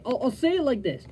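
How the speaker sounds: normal talking voice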